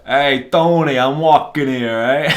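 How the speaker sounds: loud, hammed-up New York wise guy; brash New York street-guy impression; mock New York accent. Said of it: "that's the New York accent".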